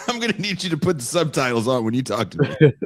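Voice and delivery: high, amused plea through laughter; laughing mock request. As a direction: say it while cracking up. Laughing through acted reply.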